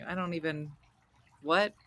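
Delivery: ordinary talk, nothing marked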